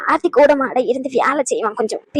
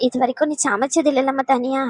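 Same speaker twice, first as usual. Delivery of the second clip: ordinary delivery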